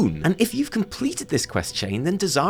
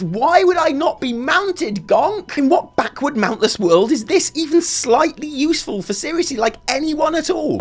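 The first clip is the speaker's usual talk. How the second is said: exasperated; 9 semitones higher than usual. Acted. Mock-outraged rant at Gonk.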